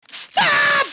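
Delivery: a high, loud yelled stop; sudden shout. Real, not acted. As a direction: cut yourself off with a yell. Sudden outburst at herself.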